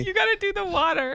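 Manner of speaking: high put-on voice for the TSA agent; mock TSA agent. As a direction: squeak out a bossy airport agent. Mocking TSA lady's demand.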